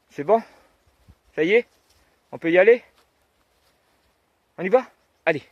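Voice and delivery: high, loud sing-song questions; chirpy voice for a dog; talking to a dog. Real, not acted. Asking the dog if it's done.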